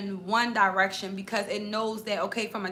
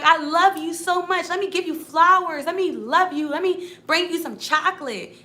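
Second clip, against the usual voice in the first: high, loud, sugary mock adoration; gushing sweet talk; 7 semitones higher, 7 dB louder than usual. Sweet-talking the hairy troll under the bridge, high gushing mock adoration. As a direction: pour on the love, way too sweet.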